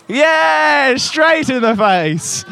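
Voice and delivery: high, loud, drawn-out cheer; excited cheer. Real, not acted. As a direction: celebrate a direct hit at the fair. Cheering a sponge hit.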